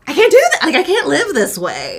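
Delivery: high, loud, laughing protest; hammed-up fed-up outburst; retold exasperation. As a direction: throw up a mock-desperate complaint. Retold breaking point over texts.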